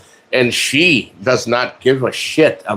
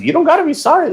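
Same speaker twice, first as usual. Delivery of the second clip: mock reassuring; faster than usual. Reassuring helper who overslept.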